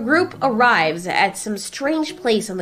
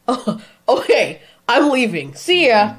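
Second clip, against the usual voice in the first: dismissive laugh, then higher-pitched brush-off; bursting out laughing; 5 semitones higher, 5 dB louder than usual. Acted. Godzilla laughs at Scylla and walks off, bursting out laughing. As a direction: laugh it off and announce the exit. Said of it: "Godzilla bursts out laughing".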